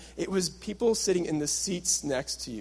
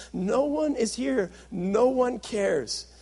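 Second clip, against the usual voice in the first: mock depressed lament; 6 semitones higher, much slower than usual. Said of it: "sit in this depressed cave and say".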